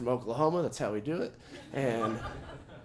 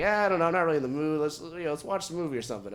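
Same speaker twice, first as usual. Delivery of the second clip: quick, offhand wife; lightly voiced wife, fast and dismissive; wife brushing off; 3 semitones higher, faster than usual. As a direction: rattle it off fast, not in the mood. Wife quoted brushing him off.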